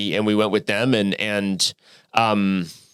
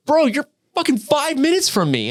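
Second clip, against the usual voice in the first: much higher, incredulous exclamation; amazed disbelief. Retold or imitated. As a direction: blurt it out in shock. Retold shock, friend lives nearby.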